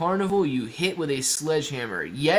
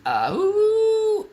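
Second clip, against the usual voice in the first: surprised squeal; 5 dB louder than usual. Real, not acted. Reacting to the chart.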